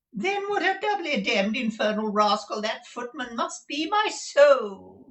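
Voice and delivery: high, loud, slowing on my soul; remonstrating dandy. A narrator's reading. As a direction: protest like a theatrical fop. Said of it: "remonstrated Mister Mantalini".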